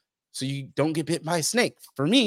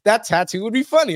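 quoting wife; 8 semitones higher, 5 dB louder than usual. Retold or imitated. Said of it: "my wife was like".

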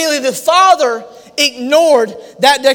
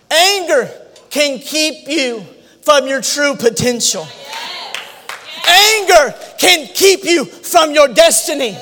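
full-force preaching; 7 semitones higher than usual. Acted. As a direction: pound the word anger again and again.

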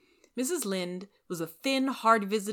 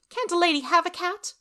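much higher, loud indignation; indignant lady; 13 semitones higher, 7 dB louder than usual. Acted. Rachel huffing at Anne, high and loud. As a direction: huff it out.

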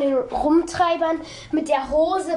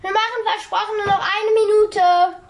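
sing-song called reply, raised; calling back; 5 semitones higher, 5 dB louder than usual. Real, not acted. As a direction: shout back across the house. Answering the dinner call.

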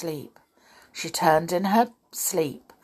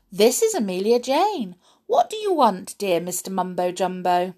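doll pretending on telephone; 2 semitones higher than usual. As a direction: show off to an imagined caller.

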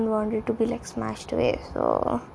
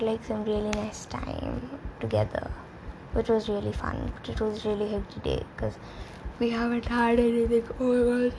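normal talking voice

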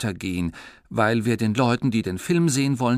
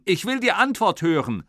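shouting; 9 semitones higher, 4 dB louder than usual. Acted. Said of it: "hat Papa gerufen".

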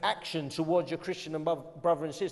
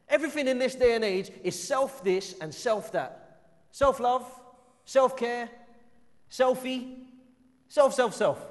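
fired-up preaching; 9 semitones higher, 6 dB louder than usual. Acted. Preacher mocking self-obsessed culture.

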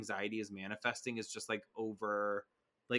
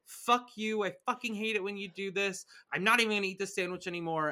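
raised-pitch bratty outburst, imitated; mock tantrum; 7 semitones higher, 3 dB louder than usual. Host voicing a daughter furious over her sandwich, petulant. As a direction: act out a cranky fit, higher and insistent.

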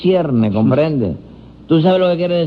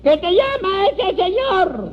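very high, drawn-out holler; high-pitched insistent call; shouting to the deaf; 13 semitones higher than usual. Acted. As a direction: repeat it slowly for a deaf man. Repeating for a deaf man.

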